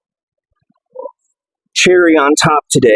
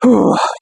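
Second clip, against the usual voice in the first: heavy sigh; 9 semitones higher than usual. Real, not acted. Exhale after heavy set.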